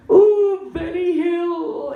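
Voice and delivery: very high, drawn-out mocking whoop; cooing women customers. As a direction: imitate a tableful of women hooting. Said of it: "they're all going".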